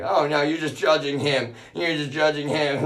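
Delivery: high, loud whiny mock protest; hammed-up shrill scolding; mock defensive relatives. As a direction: whine it back like the defensive family. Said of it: "and it's like".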